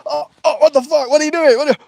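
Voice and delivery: high loud panicked "what are you doing"; startled outrage. Acted. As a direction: yelp in shocked alarm, fast at the end. Acted startled, defensive quarrel.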